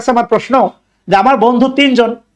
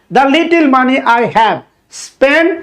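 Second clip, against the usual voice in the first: slow dictation; much slower than usual. Shown as a demonstration. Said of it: "teacher dictates the example sentence".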